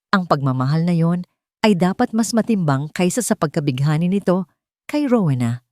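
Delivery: ordinary delivery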